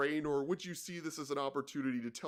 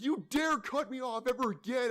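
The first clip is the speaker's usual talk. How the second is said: much higher, menacing imitated rage; mock threat; 9 semitones higher than usual. Road rager threatening the driver who cut him off, imitated.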